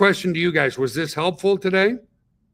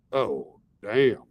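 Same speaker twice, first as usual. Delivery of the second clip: shocked gasp; 7 dB louder than usual. Retold or imitated. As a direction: gasp like a client hearing bad news. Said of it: "you'd be like".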